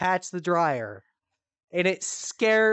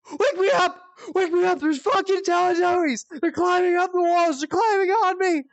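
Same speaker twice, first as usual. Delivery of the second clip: high, loud imitated panic scream; panicked screaming; 13 semitones higher, 5 dB louder than usual. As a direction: shriek like mom trapped in a nightmare. Mom screaming in her sleep.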